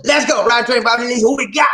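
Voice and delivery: loud, high hype call; excited live-stream hype; hype shout. Real, not acted. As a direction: pump up the crowd. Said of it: "hyping the live stream".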